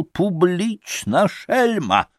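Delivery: high, slow, drawn-out insult; furious pan, theatrically outraged; enraged cry. A narrator's reading. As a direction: hurl it slowly at high pitch. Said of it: "закричал".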